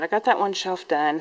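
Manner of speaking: ordinary delivery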